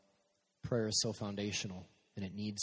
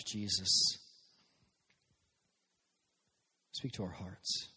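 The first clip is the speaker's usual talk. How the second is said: prayer. Real, not acted. Leads a group prayer.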